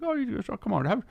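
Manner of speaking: high, merry imitation of an Irish islander; Irish accent. Island man offering the young student a pipe, Irish accent. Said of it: "I can't do his accent very well".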